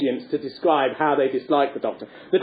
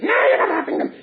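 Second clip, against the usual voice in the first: high put-on Punch voice, snapping back; Punch squawk; 13 semitones higher than usual. Punch refusing Joe the sausages, high puppet squawk.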